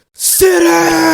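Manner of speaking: very high, loud screaming order; screamed command. Retold or imitated. Man screaming at the guest to sit down, furious. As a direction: scream it like a madman. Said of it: "him saying it's so authoritative".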